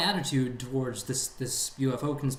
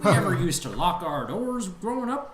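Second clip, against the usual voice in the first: put-on very old man; 4 semitones higher, 3 dB louder than usual. Old man bit continued.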